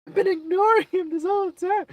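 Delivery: high, dramatic cartoon pony whine; Rarity voice. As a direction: gasp it out like a horrified pony. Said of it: "then she's like".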